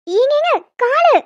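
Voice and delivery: high, slow pained cry; ouch, my leg. Real, not acted. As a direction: yelp as he grips her foot too hard. Husband grabs her leg. (கால் வலியில் உயர்ந்த, மெதுவான அலறல்)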